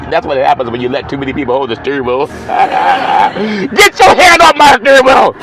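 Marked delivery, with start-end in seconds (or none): 2.3-5.3 high, laughing holler; comic high-pitched outburst after laughing; mock scolding yell. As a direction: yell it in hammed-up protest. Mock scolding of backseat drivers.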